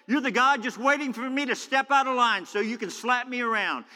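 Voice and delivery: high, loud accusing rant; Jonah not holding back. Acted. Jonah accuses God of waiting to punish him, angry and loud. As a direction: throw the accusation straight at God. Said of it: "He's not holding back anything".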